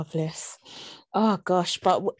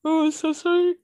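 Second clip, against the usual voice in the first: high, stretched apology mid-yawn; real yawn pushing the pitch up; talking through a yawn; 9 semitones higher, 5 dB louder than usual. Real, not acted. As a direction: apologise as a yawn takes over. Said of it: "Even just talking about yawning".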